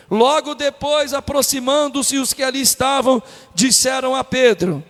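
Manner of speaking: loud, slow, drawn-out verse reading; preached scripture reading. Acted. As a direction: declaim the verse to the whole congregation. Reads the verse at full force.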